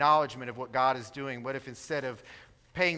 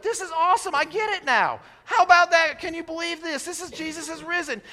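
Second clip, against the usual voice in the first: much higher and louder, bursting with joyful discovery; excited exclamation; 12 semitones higher, 9 dB louder than usual. Retold or imitated. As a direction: shout the good news like you just found out. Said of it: "They went".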